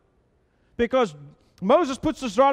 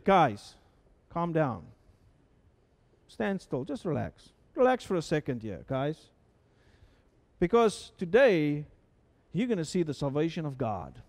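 calm reassurance; 7 semitones lower, 4 dB quieter than usual. Acted. Preacher voices Moses calming Israelites.

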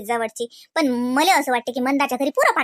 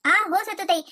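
usual speaking voice

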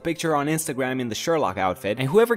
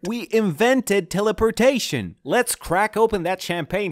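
excited; 7 semitones higher, 3 dB louder than usual. Acted. Machine works, celebrating.

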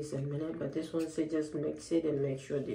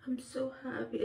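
voiced, tearful joy; happy through tears; real relieved tears; teary happiness; 9 semitones higher than usual. Real, not acted.

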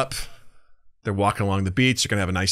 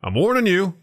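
raised, high-pitched scolding; mock stern warning; 9 semitones higher than usual. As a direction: warn it in hammed-up exasperation. Man scolds cookie in joke.

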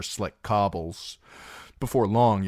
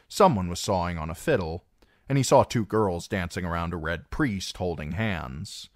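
plain reading voice

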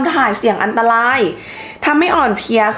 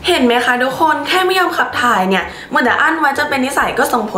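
presenter voice; 2 semitones lower than usual. Acted. Addresses viewers with health advice.

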